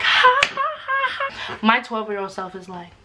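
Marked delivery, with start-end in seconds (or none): 0.0-1.6 high, mocking fake laugh; family mockingly laughing in her face; mocking laughter. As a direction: cackle high at the kid. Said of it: "they start laughing in my face".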